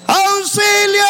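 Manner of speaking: un grito pidiendo ayuda, más agudo; grito de auxilio. Retold or imitated. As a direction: grita "¡Auxilio!" como perdido en la selva. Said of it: "Voy a gritar". (grito desesperado de auxilio en la selva)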